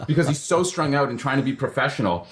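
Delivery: ordinary talk, nothing marked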